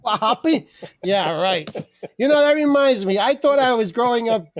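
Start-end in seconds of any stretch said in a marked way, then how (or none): none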